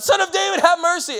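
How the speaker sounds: high, loud desperate plea; crying out. A narrator's reading. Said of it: "And he cried out".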